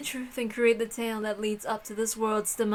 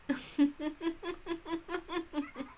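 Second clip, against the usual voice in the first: soft witch laugh; 6 semitones higher, 9 dB quieter than usual. Acted.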